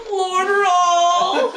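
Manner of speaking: high, loud, laughing cry of the name; an overjoyed mock squeal of Lord Rahl; joyful cry. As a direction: squeal it out in delight, half-laughing. Berdine's joyful cry, retold.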